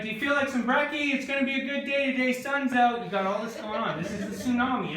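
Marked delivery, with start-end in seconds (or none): 0.0-3.0 high, loud and fast morning cheer; chipper dad. Retold or imitated. Dad waking the teens for breakfast, high and cheery. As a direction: bounce in like a dad who's been up for hours. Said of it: "So he's like".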